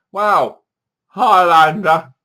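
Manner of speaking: loud and slow, stretching out Highlander; mock amazement at the screen; awed exclamation. Mock awe at the film.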